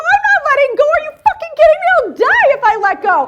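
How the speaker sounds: very high, loud frantic refusal; fast, hysterical mock protest; hammed-up panicked yell. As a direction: shriek back in comic terror. Screams back at the woman.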